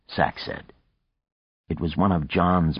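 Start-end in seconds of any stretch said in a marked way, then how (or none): none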